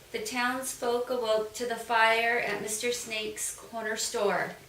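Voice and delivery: ordinary delivery, nothing marked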